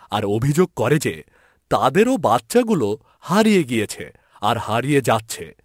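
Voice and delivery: usual speaking voice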